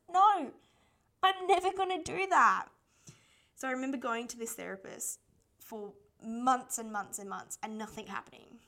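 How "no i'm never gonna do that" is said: put-on loud, high teenage protest; a defiant teen shouting back at her mum; defiant refusal. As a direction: fling back a stubborn no. Teen refusing mum's audiobook idea.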